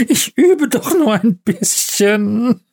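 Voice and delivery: giggly, high, slow and slurred Santa; laughing mumble. A narrator's reading. Stoned old Santa excusing himself, laughing and mumbling. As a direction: drawl it tipsily through helpless giggles. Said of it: "konnte gar nicht mehr aufhören zu lachen und nuschelte".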